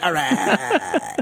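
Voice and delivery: drawn-out mock New Yorker approval; grudging approval. Drivers accepting a fellow native, approving holler. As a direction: imitate impressed drivers conceding. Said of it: "And they're like".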